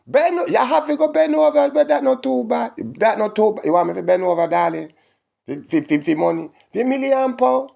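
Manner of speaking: high, loud mocking disbelief; mocking high patois taunt; incredulous mock. Real, not acted.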